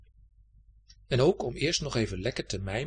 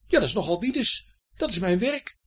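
high, raised-voice retort; calling out; 9 semitones higher, 5 dB louder than usual. A narrator's reading. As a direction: exclaim it, put out and insistent. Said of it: "riep Herfst". (verontwaardigd uitroepen, hoog)

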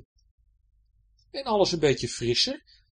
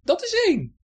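high, satisfied counting voice; Betje counting; 7 dB louder than usual. Acted. Betje counting fallen pirates, smug. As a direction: tick off the first one with relish. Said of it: "telde Betje".

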